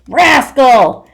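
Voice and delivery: mother shouts across the house; mom yelling. Acted. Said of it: "Then my mom yells".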